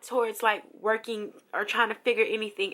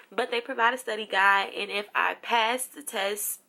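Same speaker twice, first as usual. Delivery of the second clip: ordinary talk, nothing marked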